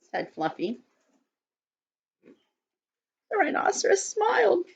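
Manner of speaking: put-on gentle storybook narration for kids; children's story reading. A narrator's reading. Teacher reading a story to children, gently. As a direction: read it to the circle like a picture book. Reads picture book to children.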